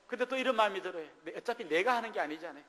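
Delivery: normal talking voice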